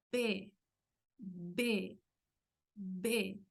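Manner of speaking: B, B, B: lips pressed, air bursts out; explosive B. Shown as a demonstration. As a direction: pop the lips on each B, B, B. (b explosiva con los labios)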